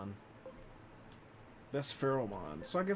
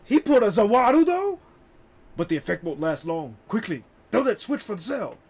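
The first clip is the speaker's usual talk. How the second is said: dramatic; 6 semitones higher, 9 dB louder than usual. Acted. Character dialogue read dramatically.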